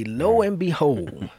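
lightly voiced Grandpa, drawn out; Grandpa's phrase. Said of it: "as Grandpa would say".

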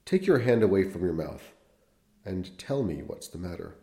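measured literary read-aloud; unhurried storyteller voice; story narration. Acted.

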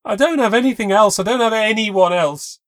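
high, loud desperate cry; tearful plea. A narrator's reading. Kayla pleading that she loves him, loud and tearful. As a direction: plead through tears.

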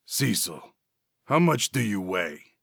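grind it like a diesel engine; grating gravel voice. Acted. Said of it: "His vocal cords grated his voice like cheese".

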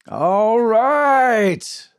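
loud booming call. A narrator's reading. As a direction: boom it over the crowd. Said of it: "boomed a man's voice".

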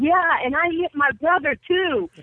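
high, eager retold brag; eager boast. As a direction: blurt it out like a proud kid. Retold eager kid's boast to Ali.

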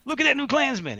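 high-pitched mock heckle; Love Kills impression. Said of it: "First thing he said at me".

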